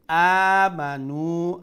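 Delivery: আমানু slowly chanted, first and last vowels held; loud, stretched opening vowel on আমানু; one alif long. Shown as a demonstration. Teacher demonstrating madd, long chanted vowels at both ends of আমানু. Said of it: "এক আলিফ লম্বা করি".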